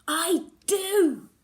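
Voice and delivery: firm. Acted. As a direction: plant your feet and insist. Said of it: "I tell her firmly".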